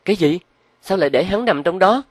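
very high, startled woman's voice; shocked disbelief. Acted. Loan learns where the body is hidden, shocked. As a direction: blurt the question out, aghast.

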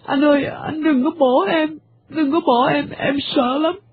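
cling to him and beg through tears; whimpering plea. Acted. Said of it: "rên rỉ". (rên rỉ van xin trong nước mắt)

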